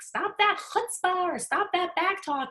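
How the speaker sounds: high, slow mock scold; old-school scolding parent. Mom recalls her parents scolding, high and slow. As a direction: imitate a strict parent telling off a kid. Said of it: "what I grew up with was like".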